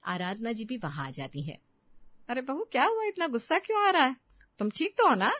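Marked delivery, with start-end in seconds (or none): 2.3-5.3 high, questioning worry; taken-aback mother-in-law checking on her; alarmed concern. Acted. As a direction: ask in startled concern. Mother-in-law worried by the angry outburst.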